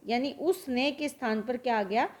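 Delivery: ordinary talk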